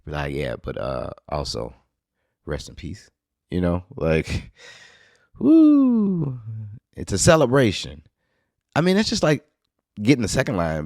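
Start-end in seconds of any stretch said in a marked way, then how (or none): 0.3-3.1 low, soft, awkward imagined reply; awkward condolence. Pretend. Replying to a fan at a funeral, awkward condolence. 5.4-6.4 high, drawn-out woo; celebratory whoop. Real, not acted. Cheering a funeral as a celebration, whoop.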